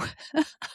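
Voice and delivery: a brief self-conscious laugh; small laugh. Real, not acted. Amused at own phrasing.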